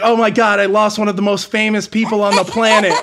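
high, loud panicked wail; mock panic. Driver retelling his horror at losing a famous star, high and loud. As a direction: blurt it out in hammed-up horror. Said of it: "I was terrified in the moment".

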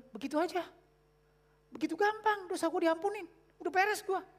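high, sing-song mockery of the easy believer; high mocking imitation. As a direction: parody someone who thinks it is that simple.

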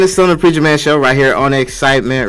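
loud, high hype-man opener; hyped radio DJ intro. Acted. Radio host greeting the listeners with loud hype.

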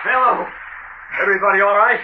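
high, projected call to scattered friends. Acted. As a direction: holler across the water to check on them. Calling to friends in water.

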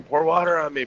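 imitating a stung guy pleading, pitched high; mock scream. Stung soldier begging for water, mock high cry. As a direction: retell his panicked cry, lightly. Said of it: "he hears this one guy screaming".

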